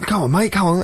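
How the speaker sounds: high, needling pest voice; mock high-pitched egging-on; pushy drunk. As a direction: imitate a drunk goading a mate. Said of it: "started slapping me and going".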